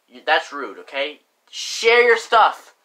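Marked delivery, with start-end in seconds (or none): 1.5-2.7 emphatic. Acted. Lecturing viewers on sharing.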